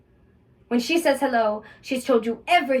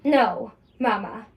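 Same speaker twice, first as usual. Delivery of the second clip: normal talking voice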